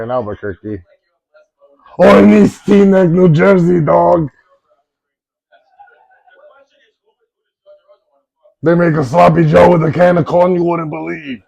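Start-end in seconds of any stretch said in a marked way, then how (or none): 2.0-11.4 loud, higher put-on Jersey voice; loud Joey Diaz-style rant; Joey Coco Diaz impression. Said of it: "Joey Coco Diaz impression".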